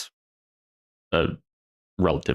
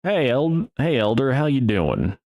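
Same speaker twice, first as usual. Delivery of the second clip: higher-pitched Texan greeting; Texan accent; 5 semitones higher than usual. Retold or imitated. Texan greeting the elder, Texas accent. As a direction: hail someone in a Texas twang. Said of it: "this Texan walks up to me".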